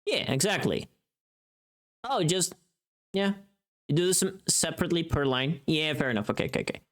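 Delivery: normal talking voice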